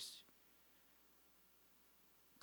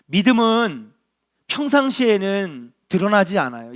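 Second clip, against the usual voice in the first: preaching at full force; 3 semitones higher, 8 dB louder than usual. Acted. Pastor urging the congregation on, preaching loudly. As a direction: preach it out to the whole hall.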